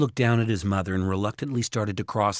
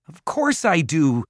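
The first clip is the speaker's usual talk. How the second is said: higher, drawn-out protest; raised, indignant retort; yelling; 7 semitones higher, 4 dB louder than usual. A narrator's reading. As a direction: yell it back at him. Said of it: "Jared yelled".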